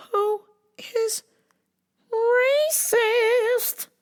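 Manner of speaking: high, strained crying squeak; voice breaking. Real, not acted. Voice breaks on racist.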